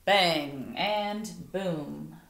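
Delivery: usual speaking voice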